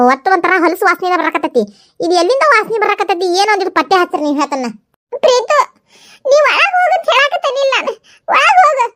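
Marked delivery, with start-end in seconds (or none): 5.2-8.9 higher, nervous laughing woman; higher voice, flustered and laughing. Acted. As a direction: caught off guard, stammering in a high voice. High character sending daughter inside.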